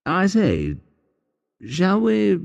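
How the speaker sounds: a mild, hesitant hint at the bedroom; coy English invitation. Acted. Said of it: "If he wants to sleep with her".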